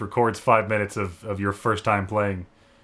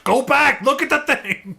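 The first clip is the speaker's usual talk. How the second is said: frustrated backseat yelling; slower than usual. Retold or imitated. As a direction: yell instructions at a clueless player. Acting out frustrated backseat player.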